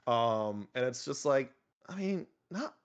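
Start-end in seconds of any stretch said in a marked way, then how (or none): 1.9-2.7 higher, halting protest; exasperated sputtering. Acted. Painter reacting to a standard everyone must follow, exasperated sputter. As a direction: sputter in disbelief, breaking off with sighs.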